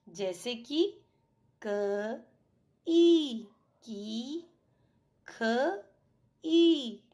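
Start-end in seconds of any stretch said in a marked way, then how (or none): 2.9-3.5 ई drawn out long, higher and much louder; long ई sound. Shown as a demonstration. Teacher showing students the long ई, held loud and high. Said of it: "इसका उच्चारण लंबा होता है". 6.4-7.0 ई drawn out long, higher and much louder; long ई sound. Shown as a demonstration. Teacher showing students the long ई, held loud and high. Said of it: "इसका उच्चारण लंबा होता है".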